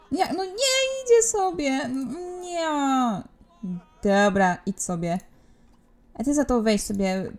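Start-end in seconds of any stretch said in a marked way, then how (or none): 0.1-2.7 whiny dismay. Real, not acted. Guest leaves, she whines.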